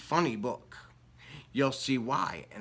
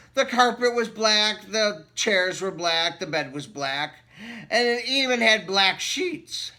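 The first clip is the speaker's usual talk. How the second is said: lively read-aloud storybook voice; story narration; 3 semitones higher, 3 dB louder than usual. Acted. Reading a picture book to children, lively storytelling voice. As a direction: read it like bedtime story time.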